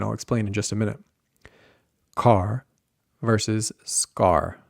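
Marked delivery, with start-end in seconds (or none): 2.1-4.6 airy k in Car, reduced in scar; slow, separated Car and scar, only Car aspirated; aspiration contrast. Shown as a demonstration. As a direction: puff on Car, none on scar. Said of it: "not nearly as aspirated".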